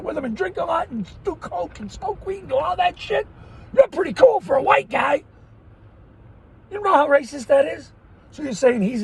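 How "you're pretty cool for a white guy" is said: mocking quote.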